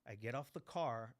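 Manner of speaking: normal talking voice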